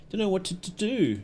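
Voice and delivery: put-on soft, stammering little child voice, a touch of fear; a lightly voiced frightened kid stuttering through it; scared child. Reading crying child's dialogue.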